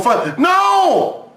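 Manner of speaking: mock scream; mock anguished shout. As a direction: yell out in hammed-up regret. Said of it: "start going".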